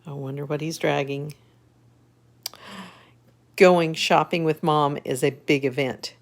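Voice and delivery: ordinary delivery, nothing marked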